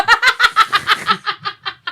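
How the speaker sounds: loud squealing laugh; high shrieking laugh. Real, not acted. Refusing gossip question.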